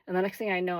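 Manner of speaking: ordinary delivery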